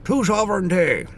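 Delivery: high, loud, grand posh Englishman; posh English accent. Pretend. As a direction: toast it like a pompous English gent. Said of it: "that sounds like a bloody posh Englishman".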